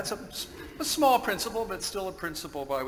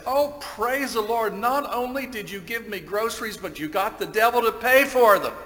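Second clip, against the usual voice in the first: high-pitched exultant cry, building louder; jubilant praise; 7 semitones higher, 7 dB louder than usual. Acted. Lady thanking God as she claps her hands, jubilant praise. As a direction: rejoice out loud, rising to a big punchline. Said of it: "began to jump up and down".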